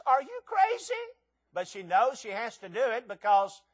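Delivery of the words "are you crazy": high, loud disbelief; wife scoffing at husband; incredulous wife. Retold or imitated. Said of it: "And she says".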